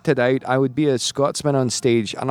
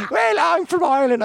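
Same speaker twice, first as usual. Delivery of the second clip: put-on high-pitched kid's Irish accent. Schoolboy showing off his Irish accent to a friend. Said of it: "Well, do an Irish accent".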